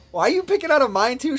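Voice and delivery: loud, high-pitched mock outrage; high, hammed-up indignation; indignant protest. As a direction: retell it as an incredulous complaint. Acted protest to girlfriend.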